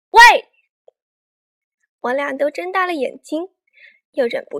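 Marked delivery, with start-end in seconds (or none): 0.1-0.2 big forceful one-word yell; loud shout. A narrator's reading. Child stepping up to a classmate, loud shout. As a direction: give it everything in a shouting match. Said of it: "大声喊".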